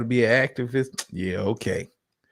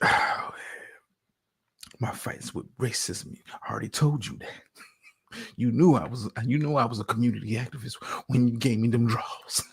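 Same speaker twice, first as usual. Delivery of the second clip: low, soft mock of the boyfriend's serious voice; lightly mocking the earnest activist; imitating his low talk; 4 semitones lower, 5 dB quieter than usual. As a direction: hush it down and go solemn like him. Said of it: "He's talking like this".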